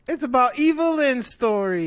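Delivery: high, loud, sarcastic reveal; mocking announcement. Real, not acted. Fan revealing who the show is about, mocking. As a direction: raise it up in scornful fanfare. Mocking the show's real focus.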